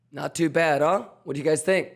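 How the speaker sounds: ordinary talk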